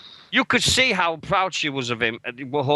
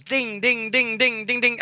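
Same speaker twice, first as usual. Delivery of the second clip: higher sing-song dings like a notification chime; playful higher imitation of message alerts pinging; phone ding imitation; 8 semitones higher than usual. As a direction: mimic the phone chiming over and over, pitched up. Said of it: "Every ten seconds".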